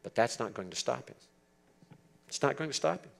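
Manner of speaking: ordinary talk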